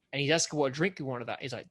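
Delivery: ordinary talk, nothing marked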